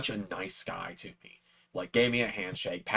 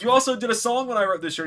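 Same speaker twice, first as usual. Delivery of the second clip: excited; 14 semitones higher, 6 dB louder, faster than usual. Retold or imitated. Excited fan meeting his hero.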